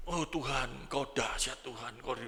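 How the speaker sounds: soft, slow, awed praise to God; awed prayer. Acted. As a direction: whisper your wonder at God's power. (doa kagum yang pelan dan lembut)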